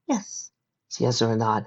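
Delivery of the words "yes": put-on young filly voice. Fireside's voice.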